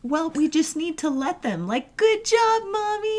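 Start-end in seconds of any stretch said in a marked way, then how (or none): 2.0-3.2 put-on cheering toddler voice. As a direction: squeal it like a proud little kid. Voicing a cheering three-year-old.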